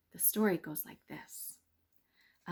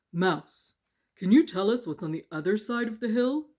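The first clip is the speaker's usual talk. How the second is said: stone voices; 2 semitones higher, 8 dB louder than usual. Acted. Stones asking.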